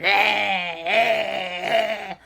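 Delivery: drawn-out creature cries; crocodile ghost noise. Retold or imitated. Crocodile ghost chasing everyone, imitated monster cries. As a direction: bleat out repeated monster calls. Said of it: "the crocodile ghost went".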